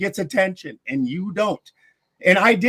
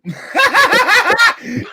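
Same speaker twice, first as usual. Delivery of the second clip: a loud, high burst of laughter; cackling at his rant; 14 semitones higher, 4 dB louder than usual. Real, not acted. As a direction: crack up hard at him.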